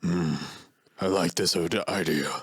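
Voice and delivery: hammed-up drummer impression, low and measured. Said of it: "do the really good [name] impression".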